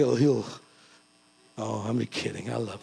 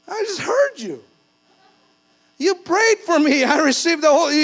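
surprised churchgoer; 10 semitones higher, 4 dB louder than usual. Retold or imitated. Church members surprised he doesn't speak Spanish.

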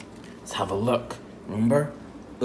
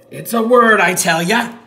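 loud, high pirate cry; excited pirate; 10 semitones higher, 8 dB louder than usual. Pretend.

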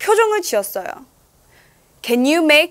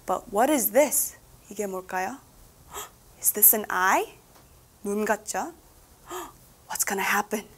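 much softer, whispered suspense; hushed suspense; 3 semitones lower, 8 dB quieter, faster than usual. A narrator's reading. Reading to a child, hushed suspense before the reveal. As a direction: lean in and hush, something is coming.

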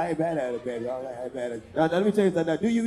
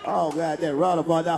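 ordinary talk

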